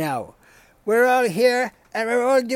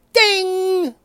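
high sung ding; mock game show ding; ding chime; 8 semitones higher, 3 dB louder than usual. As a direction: imitate the correct-answer bell.

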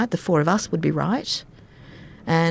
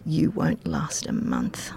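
low, slow, heavy voice for the father-in-law; doubting father-in-law; 12 semitones lower, 4 dB quieter, slower than usual. Retold or imitated. Retelling her father-in-law's warning, low and slow. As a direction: drop way down and drag out the doubt.

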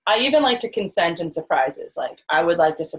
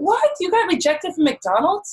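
incredulous; 13 semitones higher than usual. Real, not acted. Hearing partner got rejected, high-pitched disbelief. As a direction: gasp it out in playful outrage.

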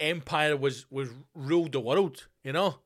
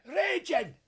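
squeaky raging; 14 semitones higher than usual. Acted. Mocking [name], squealing in a high voice.

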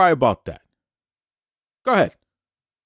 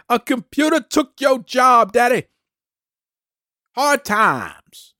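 higher, slower imitation of Dusty Rhodes's voice; Dusty Rhodes impression; 7 semitones higher, slower than usual. Mocking Cody by voicing his late father Dusty, imitating Dusty Rhodes. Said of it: "That's my Dusty Rhodes impersonation".

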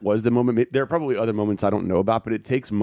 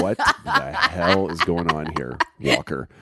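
laughing disbelief; 4 semitones lower, slower than usual. Retold or imitated. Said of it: "And I was like".

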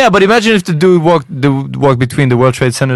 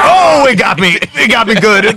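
mock wounded pitchman; 6 semitones higher than usual. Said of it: "And he's like".

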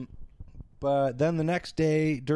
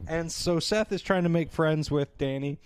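ordinary talk

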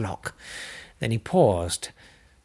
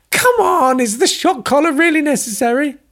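much higher, louder desperate scientist; shouting captive; 6 dB louder than usual. Acted. Captive astrophysicist yells at his captors, shrill and desperate. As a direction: shout through the door, fed up.

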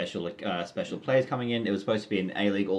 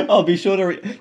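laughing; 7 semitones higher, 7 dB louder than usual. Real, not acted. Host laughs at feedback.

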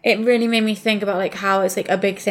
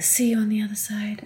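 sultry whisper; 5 dB quieter than usual. Pretend. Imagined seductive farewell, whispered.

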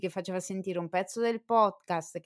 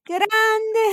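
excited; 11 dB louder than usual. Real, not acted. Surprised thanks live on air.

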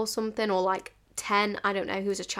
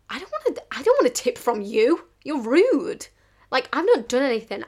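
high, loud mock-indignant protest; indignant retort; 4 semitones higher, 5 dB louder than usual. Waitress answering a rude customer's snitch remark, indignant raised voice. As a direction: act out offended pleading, voice jumping up.

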